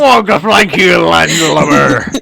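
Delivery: loud pirate growl, dragging out landlubber; hammed-up pirate. Friend playing a pirate captain, loud hammed-up pirate. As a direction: bark it like a cartoon pirate captain.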